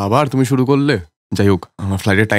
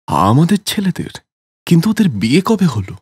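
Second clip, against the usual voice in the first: surprised; 7 semitones higher than usual. Acted. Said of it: "অবাক হয়ে".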